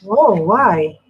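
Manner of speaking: normal talking voice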